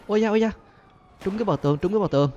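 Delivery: high yelp then relief; startled yelp. Real, not acted. Bullet hitting the wall, high startled yelp. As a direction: flinch at the near miss.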